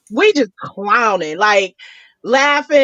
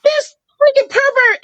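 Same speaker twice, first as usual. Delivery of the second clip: shocked outrage; 12 semitones higher than usual. Retold or imitated. He exposes himself.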